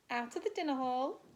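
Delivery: usual speaking voice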